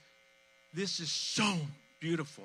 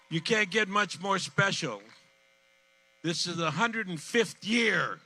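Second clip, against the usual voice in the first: excited boast; 8 semitones higher, 7 dB louder than usual. Real, not acted. Host tells the crowd proudly how old the street is, loud and excited. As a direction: sell the proud history big and loud.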